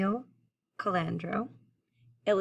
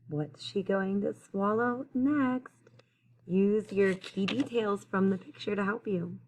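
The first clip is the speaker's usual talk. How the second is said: ordinary delivery, nothing marked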